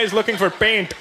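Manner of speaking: lightly mimicked Indian accent; mock Indian-accented echo. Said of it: "you're mocking us when we're not around".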